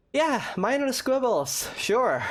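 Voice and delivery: high, drawn-out sarcastic echo; mock his "minor quibbles" with slow sarcasm. Real, not acted. Player mocking Childe's excuse, sarcastic and slow.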